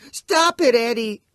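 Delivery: put-on very high, loud child's scream. A narrator's reading. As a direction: scream it, terrified little boy. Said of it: "Billy screams".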